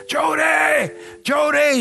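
high, insistent holler, repeated; imitated shouting for his wife; yelling. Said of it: "He's calling and calling".

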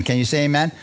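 usual speaking voice

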